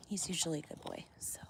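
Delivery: soft unvoiced whisper. Real, not acted. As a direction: keep it hushed while watching. Hushed while watching animal.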